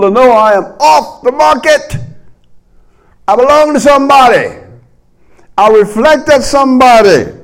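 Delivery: high, loud, fervent preacher build; fiery preaching. Acted. Preacher rousing the congregation, fervent and loud.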